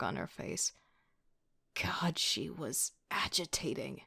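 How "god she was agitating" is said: slow irritated whisper; annoyed whisper. A narrator's reading.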